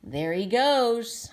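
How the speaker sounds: ordinary delivery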